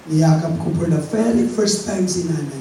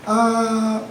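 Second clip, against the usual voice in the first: normal talking voice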